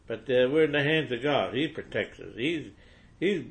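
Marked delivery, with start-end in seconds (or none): none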